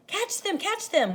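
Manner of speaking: put-on children chasing the lights; excited cries. Kids shout to each other chasing fireflies, excited cries. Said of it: "we cried".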